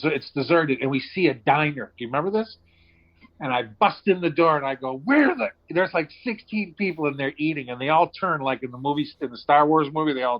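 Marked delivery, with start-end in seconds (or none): 5.0-5.5 loud high yell into the room; shouted demand. Retold or imitated. Barging into a diner, asking for gas with a loud yell. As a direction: bust in and demand it loudly.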